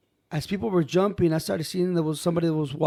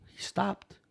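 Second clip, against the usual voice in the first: soft, held-back storytelling; hushed suspense; 2 semitones higher, 8 dB quieter than usual. Real, not acted. Storyteller reveals the passing figure's halt, hushed. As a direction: drop it softly for suspense.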